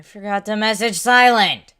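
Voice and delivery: loud, high startled outburst; sudden realization. Real, not acted. Streamer suddenly remembering a friend, shouted. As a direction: blurt it as it hits you.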